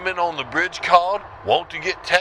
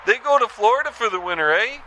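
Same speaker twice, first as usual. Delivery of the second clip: put-on higher, chirpy Canadian accent ending on eh; hammed-up Canadian caller tacking on eh; 8 semitones higher than usual.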